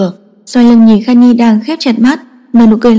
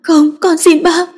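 sobbing plea; 7 semitones higher than usual. A narrator's reading. Daughter begging her father through tears. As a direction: beg through sobs to save the child. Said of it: "Kha Nhi nức nghẹn".

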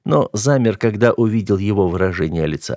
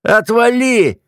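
high-pitched shouted brush-off; shouted in annoyance, pitched way up; angry yell; 14 semitones higher, 4 dB louder, slower than usual. A narrator's reading. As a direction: yell it to shove someone away. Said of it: "заорал он".

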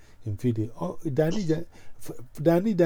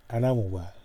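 normal talking voice

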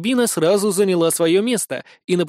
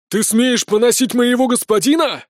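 loud, pompous outraged exclamation; hammy stage actor; 7 semitones higher, 5 dB louder than usual. Acted. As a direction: declaim it grandly, offended. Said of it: "высокопарно воскликнул сухопарый эльф".

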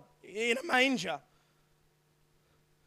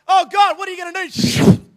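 higher, louder alarmed cry; mock panic, pitched high and loud; panicked angels; 6 semitones higher, 8 dB louder than usual. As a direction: blurt it out in alarm. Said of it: "And they're like".

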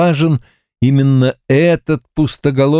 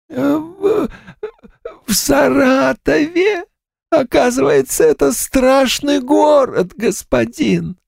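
ghost voice. Acted.

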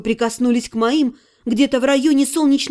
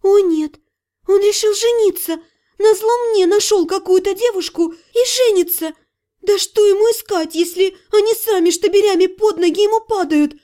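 panicked jealousy; 6 semitones higher than usual. Acted. Heroine fears her ex is marrying someone, higher and frantic. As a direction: rush through fearing he'll marry.

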